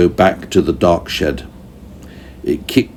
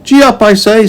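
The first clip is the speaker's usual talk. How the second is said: high, bright urging call to the animal; giddy-up shout, pitched high; Meddle's voice; 6 dB louder than usual. A narrator's reading. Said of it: "said Meddle".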